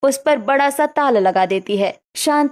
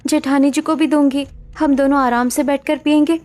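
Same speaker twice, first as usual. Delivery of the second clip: put-on higher, girlish young bride, whining in dismay; higher dismayed young woman's voice; young daughter-in-law lament; 6 semitones higher than usual. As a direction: pout over the lost cold coffee. Ruhi laments locked fridge.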